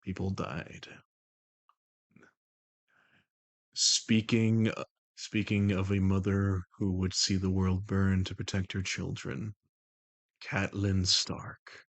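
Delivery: low, measured, even put-on voice; The Voice, composed and commanding. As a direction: keep it low and level, in unhurried control. Holds The Voice.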